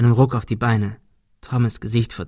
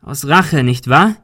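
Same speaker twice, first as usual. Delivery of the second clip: higher, louder accusing question; calling out; 3 semitones higher, 6 dB louder, slower than usual. A narrator's reading. Ben challenges the gunman, calling out. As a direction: call out the accusation. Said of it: "rief Ben".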